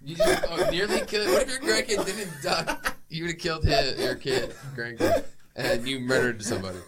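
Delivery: laughing disbelief; giggling outrage about grandpa. Real, not acted. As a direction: crack up while protesting. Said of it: "laughing through disbelief".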